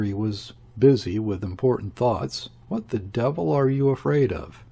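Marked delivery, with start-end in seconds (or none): none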